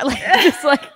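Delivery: ordinary delivery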